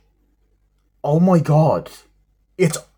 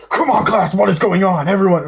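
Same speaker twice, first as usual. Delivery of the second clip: higher, louder bossy instructor; mock teacher shout; 6 semitones higher, 5 dB louder than usual. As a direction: bark at the class like a drill sergeant. Voicing the karate teacher.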